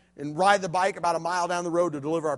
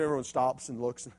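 ordinary delivery

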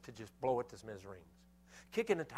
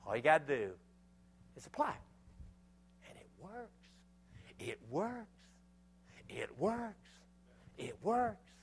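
preaching refrain; 4 semitones higher, slower than usual. Acted. Preaching climax, repeated refrain.

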